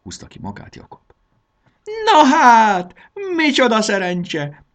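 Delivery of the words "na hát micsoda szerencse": very high, loud squeal; delighted shriek. A narrator's reading. Táska Mása hears of a famous traveller, delighted shriek. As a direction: shriek it in a put-on excited voice. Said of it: "sikított Táska Mása".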